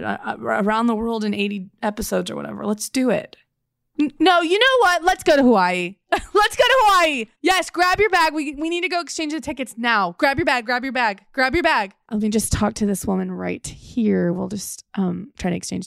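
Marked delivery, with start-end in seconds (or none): 4.0-11.9 bursting with a sudden idea; excited. Acted. Sudden excited Hawaii plan.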